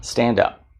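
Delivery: ordinary talk, nothing marked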